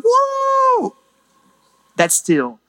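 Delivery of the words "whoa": very high, drawn-out whoop; imitated cheer. Preacher imitating the congregation hearing of a Wildcats win, mock cheer. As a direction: mimic a fan's high, long cheer. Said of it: "and you go".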